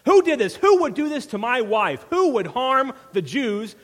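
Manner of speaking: loud, high furious demand; king yelling in fury; enraged king. Acted. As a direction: roar it as the outraged king. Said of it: "he is enraged".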